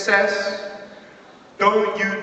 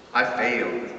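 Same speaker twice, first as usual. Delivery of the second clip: ordinary talk, nothing marked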